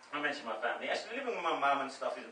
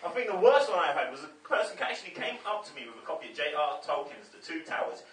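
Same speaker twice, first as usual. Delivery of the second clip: ordinary talk, nothing marked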